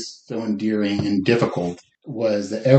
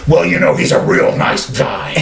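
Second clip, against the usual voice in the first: loud, higher mock rough growl of a gossipy old client; imitating Mark's rough voice; 4 semitones higher, 6 dB louder than usual. Telling a friend about client Mark, loud rough imitation. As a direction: sound like you're about to say something nasty. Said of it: "he had this rough voice".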